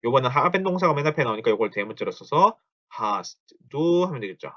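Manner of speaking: usual speaking voice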